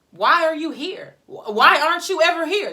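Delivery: loud incredulous mimicry of the boss; indignant mock yell. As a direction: throw her questions back, outraged. Mocking boss's questions, rising indignation.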